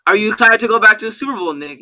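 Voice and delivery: loud, high-pitched mock reporter question; eager reporter. Podcast host plays reporter asking player about Super Bowl, loud and eager.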